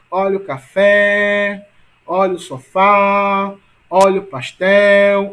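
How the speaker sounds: café, sofá, pastel called loudly, last syllable held long; street-seller stretched endings. Shown as a demonstration. Teacher selling the word to find its stress, calling out long endings. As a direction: hawk café, sofá, pastel, dragging out each final syllable. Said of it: "é exatamente vendendo a palavra".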